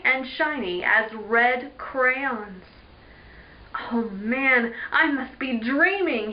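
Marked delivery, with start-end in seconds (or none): none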